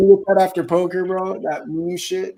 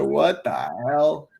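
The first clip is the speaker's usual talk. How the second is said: imitating; much slower than usual. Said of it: "this motherfucker said".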